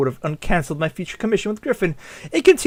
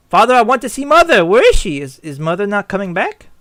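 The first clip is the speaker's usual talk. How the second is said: put-on higher little-boy voice; pleading child; 3 semitones higher, 4 dB louder than usual. Young Carl asking father for Mother, pleading child.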